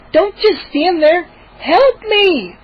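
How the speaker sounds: put-on high, slow, pleading girl voice; frantic sister. Sister yelling at the frozen boys. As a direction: demand help while being carried off. Dragged sister demanding help.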